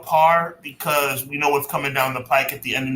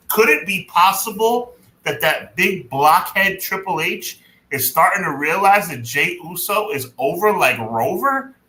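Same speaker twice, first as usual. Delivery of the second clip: usual speaking voice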